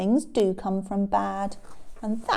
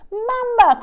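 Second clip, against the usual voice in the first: high, loud exclamation; crying out; 14 semitones higher, 10 dB louder than usual. Acted. Lion seeing Elephant trapped, loud cry. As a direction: call it out in alarm. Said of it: "cried Lion".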